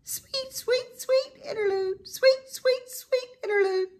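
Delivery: very high, sung birdsong; high chirping sparrow song. A narrator's reading. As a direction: trill it sweetly like a songbird.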